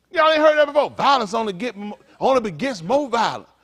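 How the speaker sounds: ordinary delivery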